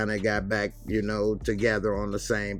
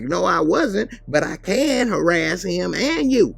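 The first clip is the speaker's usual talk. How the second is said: higher, louder and slow; sassy defiance; 9 semitones higher, 7 dB louder than usual. Retold or imitated. Kelly talking back to the cop, loud. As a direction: lay it on with attitude.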